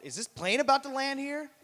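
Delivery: high, puzzled question; a man wondering at his birdhouse; mock confusion. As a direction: sound baffled. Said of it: "and thought".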